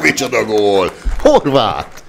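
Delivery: loud, high goal call; excited Hungarian sports commentary. Real, not acted. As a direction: roar the goal, then keep it loud.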